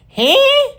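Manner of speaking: high-pitched questioning "huh"; exaggerated high rising huh; mock disbelief. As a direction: squeal it upward, incredulous.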